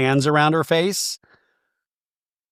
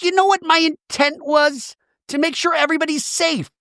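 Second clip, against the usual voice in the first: mimicking Shannon; 13 semitones higher than usual. Retelling Shannon's line at reunion.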